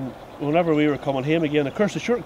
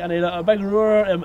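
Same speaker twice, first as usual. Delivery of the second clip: ordinary talk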